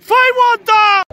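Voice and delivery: high, loud shout. Real, not acted. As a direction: yell across the pitch. Shout at the pitch.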